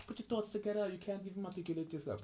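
high, mock-whiny voice of a critic; mocking taunt.

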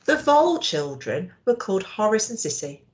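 ordinary delivery, nothing marked

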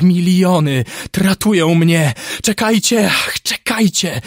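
high, loud delirious screaming building to a long howl; monotonous screaming. Acted. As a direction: scream for help in panic, sustaining the cry. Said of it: "ciągnął dalej jednostajnym krzykiem".

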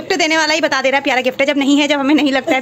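fast, loud playful mockery; loud teasing. Real, not acted. Mother teasing her sister over the gift, loud and fast mockery. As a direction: tease the giver loudly, rushing.

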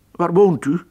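high, loud eager question; acquaintance rat voice. Acted. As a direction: call out the question to the stranger. Acquaintance asks church rat.

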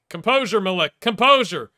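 urging shout. Real, not acted. Urging his player during play.

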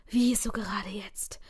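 slow annoyed whisper to herself; whispered thought. Acted. Annoyed narrator asks herself why now, slow whisper. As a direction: breathe the question out under her breath.

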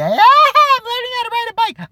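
put-on high, loud whiny little kid voice; mock kid voice. Mocking the kid on the bike path in a high whiny voice. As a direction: mimic a bratty kid learning to ride.

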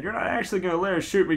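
rushed, panicky protest; fast and scared. Acted. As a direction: blurt it out fast in mock fear. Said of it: "really fast and scared".

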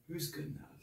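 very soft, pointed question; barely voiced questioning; hushed challenge. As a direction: ask it quietly, almost to yourself. Same question, now hushed.

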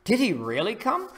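high, eager question; excitedly. Acted. Aliens asking the farmers about God, excited. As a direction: ask it bursting with hope. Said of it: "the aliens asked excitedly".

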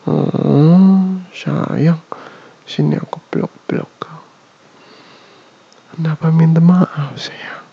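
rock her gently with your voice; tender whispered boyfriend soothing. Acted. ASMR soothing after bad dream.

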